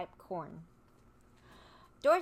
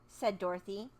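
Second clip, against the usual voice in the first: ordinary delivery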